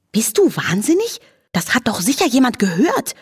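high, alarmed scolding; whinny in dismay, swooping up; whinnying protest. A narrator's reading. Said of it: "wieherte Charlotte noch".

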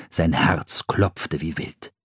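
ordinary delivery, nothing marked

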